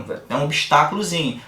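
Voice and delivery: normal talking voice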